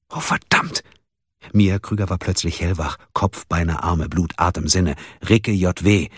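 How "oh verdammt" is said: whispered curse. Acted. Sudden realisation.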